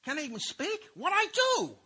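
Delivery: put-on high, squeaky protest of a wronged little kid; whiny kid brother. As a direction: pitch it way up like a kid crying unfair. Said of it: "classic response of the young kid".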